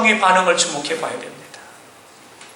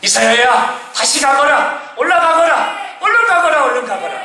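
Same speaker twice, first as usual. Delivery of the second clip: climbing, insistent call; urgent divine command; 9 semitones higher than usual. Acted. God sending Isaiah back to the king, commanding urgently. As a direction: raise each order higher than the last.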